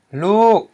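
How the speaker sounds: lu arched very high then falling; long falling-tone lu. Shown as a demonstration. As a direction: say lu loud, swooping down from high. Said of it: "which equals a falling tone".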